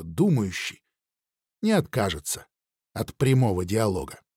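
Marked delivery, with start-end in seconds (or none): none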